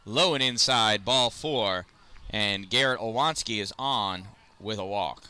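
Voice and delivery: live play-by-play. Acted. Announcing the next Chargers batter, steady play-by-play. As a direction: build to full count, then announce the walk.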